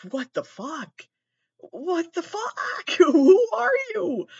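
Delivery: high, stretched-out squeal of disbelief; mock shocked outcry. Everyone reacting to the speaker at the museum, mock shocked outcry. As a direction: imitate the crowd's horrified shriek, drawn out and high.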